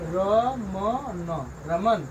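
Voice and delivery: usual speaking voice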